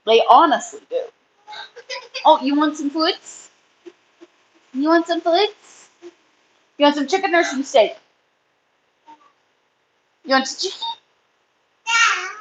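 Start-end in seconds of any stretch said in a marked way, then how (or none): 2.2-12.3 higher, sing-song coaxing to an animal; pet talk. Real, not acted. Talking to her rabbit, coaxing it to eat, higher sing-song. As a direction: coo the offer of food to a pet.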